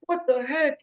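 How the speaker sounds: high-pitched mock alarm; startled, voice jumping up; retold startled protest. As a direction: yelp it as if suddenly grabbed, without extra volume. Retold being grabbed in headlock.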